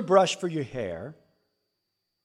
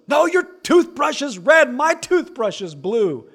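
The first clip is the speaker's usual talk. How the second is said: put-on much higher, loud bratty kid insisting; indignant child voice; 11 semitones higher, 8 dB louder than usual. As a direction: play the sibling who is sure he's right. Kids quarrel voiced.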